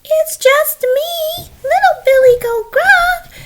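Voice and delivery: very high, drawn-out little voice; meek, very high-pitched reply; tiny baby goat. Acted. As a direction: squeak it slowly like a tiny kid goat. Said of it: "little itty-bitty baby billy goat".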